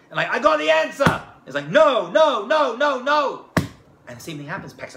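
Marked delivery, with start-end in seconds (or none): 0.3-1.2 eager boast. Retold or imitated. Boys eager in line. 1.7-3.5 a string of loud, impatient no's; loud dismissive no's. Retold or imitated. Father turning down the yeshiva boys, loud and impatient.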